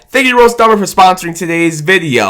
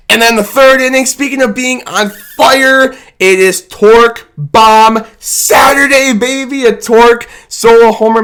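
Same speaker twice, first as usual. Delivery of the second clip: hyped; 7 semitones higher, 3 dB louder than usual. Real, not acted. Hyping Torque bomb.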